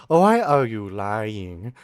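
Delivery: put-on slow, whining accusation in an Indian accent; slow Indian-accented reproach; mimicked woman caller. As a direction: imitate an Indian woman scammer, drawing it out. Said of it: "first instinct is to go like".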